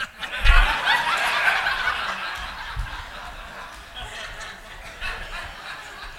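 high airless laugh; a real laugh at the memory; breathy laugh. Real, not acted. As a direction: burst out laughing. Laughing at hair being flipped.

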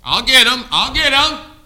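high, explosive gloating yell; the devil. Acted. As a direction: shout it as a tempter sure of the catch. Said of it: "the devil's sitting there".

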